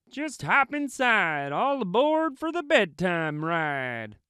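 a friendly truck calling all aboard; high cheery truck voice; Little Blue voice. A narrator's reading. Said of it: "said Blue".

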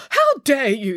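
high, outraged cook's cry; indignant yell. A narrator's reading. Cook yells at an accusation over her food, indignant yell. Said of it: "yelled Missus Marigold".

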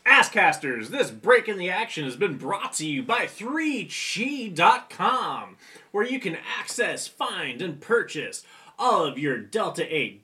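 upbeat radio sponsor read. Acted. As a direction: sell it like a radio spot.

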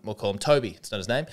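ordinary talk, nothing marked

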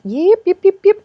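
high, loud yips; yipping. Retold or imitated. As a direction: yelp them out high with hands up. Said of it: "we were just like".

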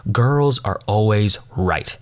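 slow, spaced-out teacher's slogan; measured, emphatic instruction; deliberate slogan. Retold or imitated. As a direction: spell it out slowly for the class. Teacher's slogan, announced slowly.

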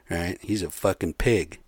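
normal talking voice

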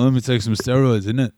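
mock British accent attempt. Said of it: "you try an accent and I'll try one".

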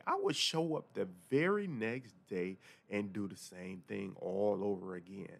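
ordinary delivery

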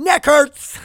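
loud, high cry of complaint; exasperated outburst. Real, not acted. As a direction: stretch it out and yell it in exasperation. Venting about neck pain, then laughs.

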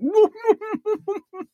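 imitating his burst of high laughter; high helpless giggle; high cackling laugh. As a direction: cackle like he can't stop. Friend cracks up at script.

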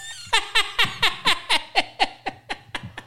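long high laugh at his own joke; high cackling laugh. Real, not acted. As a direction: burst out cackling.

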